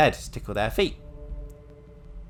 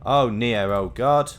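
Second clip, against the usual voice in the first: mock anguish; 3 semitones higher, 8 dB louder, much slower than usual. Mock grief over hanged Nia.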